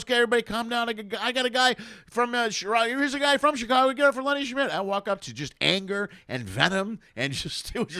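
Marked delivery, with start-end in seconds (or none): 0.0-4.7 high, pushy emcee trying to calm the room; calm a rowdy crowd like a frantic booker; flustered host. Retold or imitated. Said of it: "He goes".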